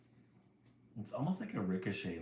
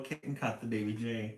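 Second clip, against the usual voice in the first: usual speaking voice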